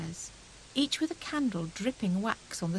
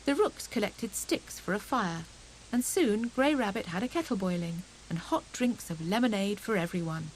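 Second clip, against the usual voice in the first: plain reading voice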